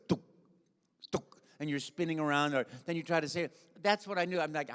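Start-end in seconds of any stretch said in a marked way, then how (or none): 0.1-1.4 sparse little clicking shots; imitated Asteroids blips. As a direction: mimic an old arcade shooter, one blip at a time. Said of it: "the asteroids where it goes".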